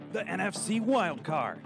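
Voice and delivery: ordinary talk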